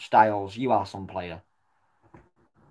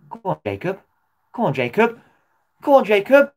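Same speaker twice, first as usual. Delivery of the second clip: each go on higher and louder; building excited urging; 10 semitones higher, 7 dB louder than usual. Real, not acted. Fan urging a player through on goal, building shout.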